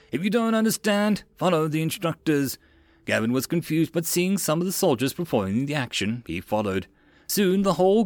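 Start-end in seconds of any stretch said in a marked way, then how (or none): 0.1-2.6 high-pitched instructor's order; commanding lieutenant; drill officer. A narrator's reading. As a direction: order the recruits in a raised officer voice. Officer ordering recruits about.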